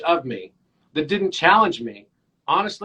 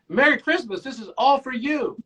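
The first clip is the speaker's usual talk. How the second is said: higher, cheerful Christmas greeting; cheerful presenting; 7 semitones higher than usual. Retold or imitated. Psychiatrist presenting toys to a little boy, cheerful. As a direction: present a gift in a lifted voice.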